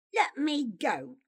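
half-shouted demand for release; shouting. A narrator's reading. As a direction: shout to be let go, somewhat restrained. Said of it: "Pyotr shouted".

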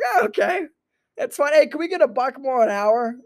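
high, loud, put-on compliant voice; high-voiced mock agreeable worker; mock meek acceptance. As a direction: pitch it way up, pleading hopefully. Voices meek public asking for raise.

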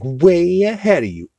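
high, put-on sleazy kid voice; sly kid Quagmire. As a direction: purr it like a smug little lech. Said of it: "he's like".

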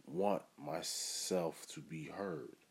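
ordinary talk

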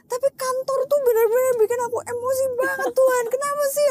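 very high, loud mock wail; crying complaint. Speaker imitates herself complaining to God about the office, wailing. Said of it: "ngomong sama Tuhan tuh kayak gitu".

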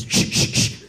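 sharp repeated shush; short hissed "shh" bursts; hushing shushes. Retold or imitated. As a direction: shush someone to listen. Brother hushing to listen.

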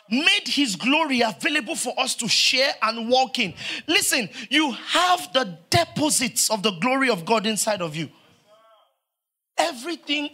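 loud, fervent sermon delivery; full-force preaching. Acted.

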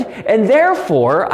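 ordinary delivery

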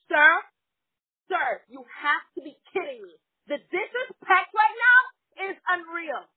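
loud, high indignant tirade; acting out a furious customer's rant; losing it. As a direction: tell him off with mounting outrage. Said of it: "I lost it on him".

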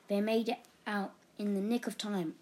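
ordinary delivery

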